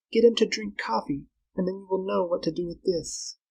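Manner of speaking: high, intent female character voice; scheming Flora. Acted. Girl coaching Carl to trick Tarzan, intent. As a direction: instruct him urgently, pitched well up.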